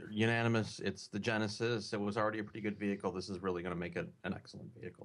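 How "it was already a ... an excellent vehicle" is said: very fast rattled-off words; rushed. Real, not acted. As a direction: race the ten-second clock. Rushes answer against clock.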